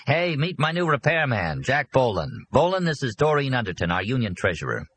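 chirpy businessman making introductions; eager host Arnie. Acted.

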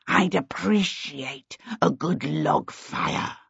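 slow, drawn-out fire demon voice; crackling fire demon. A narrator's reading. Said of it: "the fire demon crackled".

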